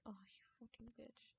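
a sharp whispered swear under the breath; whispered curse. Real, not acted. Gamer cursing at the game under his breath, whispered. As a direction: hiss the curse almost silently.

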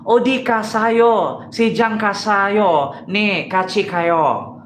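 slow mock Japanese gibberish. Guessing the language, slow mock Japanese gibberish. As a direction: drag out parody Japanese syllables.

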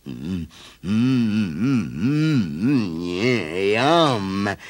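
humming with relish; savouring bear. A narrator's reading. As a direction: savour the smell. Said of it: "he thought".

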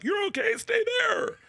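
mock panicked cop shout; high shout. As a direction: yell it like a cop. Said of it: "doesn't sound like".

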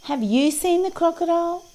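very high parrot-and-friends voice; high-pitched storybook animals asking; inquiring little creatures. A narrator's reading. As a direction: ask it way up high. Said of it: "asked the parrot".

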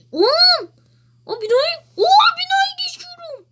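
very high, loud excited yell; excited shouting. Real, not acted. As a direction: shout it out in thrill. Excited at passing the building.

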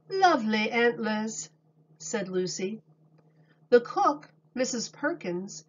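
calm storybook reading voice; reading to children. A narrator's reading. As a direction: read it to a class of little kids. Reads picture book aloud.

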